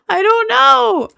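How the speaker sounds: real laughter of total confusion; laughing helplessly. Real, not acted. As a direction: giggle the words out in defeat. Stumped, laughing at herself.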